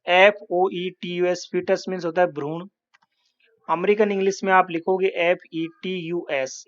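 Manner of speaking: usual speaking voice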